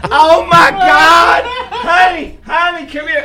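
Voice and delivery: very loud, high, stretched shriek; hysterical fan scream. Retold or imitated. Caller believing the singer is on the line, shrieking for honey. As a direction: scream it, then call out urgently. Caller thinks the star is there.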